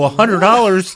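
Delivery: high, loud incredulous echo; can't believe the price he heard; shocked disbelief. Retold or imitated. Outraged retold reply.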